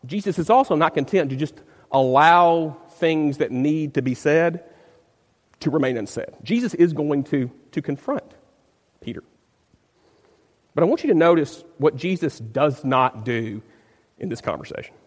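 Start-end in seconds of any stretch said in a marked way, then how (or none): none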